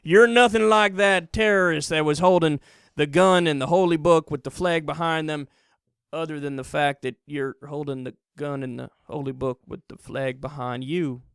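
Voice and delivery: a hint of a cheering idiot; mocking a dumb viewer. Mimicking Fox viewers cheering, lightly voiced dumb yokel. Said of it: "some idiots in the country watching that going".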